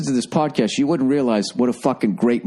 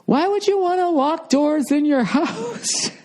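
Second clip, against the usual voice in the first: much higher, slow, baffled voice with a laugh; mocking guests; slower than usual. Guests shrug off his door locks, high slow baffled voice. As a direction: drag it out like a puzzled guest who doesn't get it. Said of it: "And they're like".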